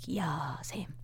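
very soft, dazed reply; smitten murmur. Retold or imitated. Storyteller relives locking eyes with a stranger, smitten murmur. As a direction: answer low, trailing to a whisper.

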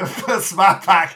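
slow, loud Boston Smart Pack; Boston accent. Retold or imitated. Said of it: "Super Bowl commercial they did".

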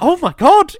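loud, high burst of real disbelief; a loud, high cry of shock; shocked exclamation. Real, not acted. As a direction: blurt it out, genuinely appalled. Shocked at controlling husband.

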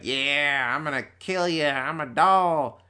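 drawn-out mock villain voice; Chucky. Chucky taunts a wrestler on the big screen, mock villain. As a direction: stretch it out like a silly menacing doll. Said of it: "And Chucky's like".

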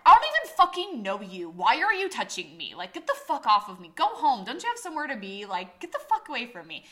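loud, high-pitched indignant outburst; angry rebuff. Retold or imitated. Woman snaps at a stranger touching her face, angry and loud. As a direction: tell someone off, voice jumping up.

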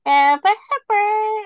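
put-on high, slow baby talk to a pet; talking to a dog. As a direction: coo at the dog in a squeaky voice. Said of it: "That's just how Sarah talks to Pepper".

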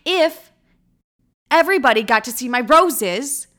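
loud, indignant retold scolding; irritated comeback with emphatic loud words; pissed off. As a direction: tell him off, acting out the argument. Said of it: "now he's pissing me off".

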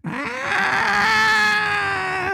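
very high shouted howl; imitated dog screech. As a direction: screech like the dog at full volume. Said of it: "mouth wide open going".

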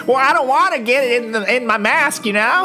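lightly voiced, snooty old woman with a laugh; mocking old lady. Host mocking the laundromat lady's excuse, in a prissy mock voice.

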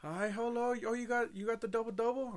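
high, sugary fake-nice cashier voice; high bright mock drive-thru voice; fake cheery cashier. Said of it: "I said".

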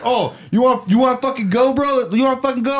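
high, goading retold challenge; mock fight challenge. Guard challenges him to fight.

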